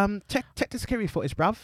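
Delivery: high, fast mock-British "bruv" line; fake British slang. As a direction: ape an actor's forced London slang. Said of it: "it's bruv".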